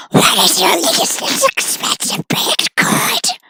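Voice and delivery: high, slow rasping whisper; creepy goblin whisper. Acted. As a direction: hiss it like a goblin. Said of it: "creepy voice".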